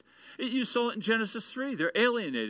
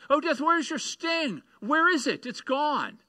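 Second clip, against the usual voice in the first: higher, louder mocking challenge to death; preacher voicing Paul taunting death, higher and louder; ridiculing taunt; 8 semitones higher, 5 dB louder than usual. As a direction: jeer at death as if it's beaten. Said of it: "He's ridiculing death".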